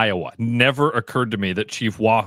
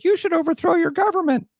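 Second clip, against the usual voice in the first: very high squeaky toy voice. Retold or imitated. A Furby toy coos at its owner, very high and squeaky. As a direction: chirp it like a tiny talking toy. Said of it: "and they'd say".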